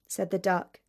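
plain reading voice

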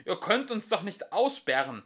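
put-on older philosopher shouting, offended. A narrator's reading. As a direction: yell it in outrage. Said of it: "schrie der ältere".

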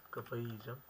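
ordinary talk, nothing marked

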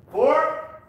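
sharp, loud, high count calls; boxing coach calling combos. Real, not acted. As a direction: bark the numbers out to the class.

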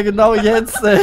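normal talking voice

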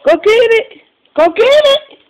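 excited urging. Real, not acted. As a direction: whip the puppy into play. Urging the dog.

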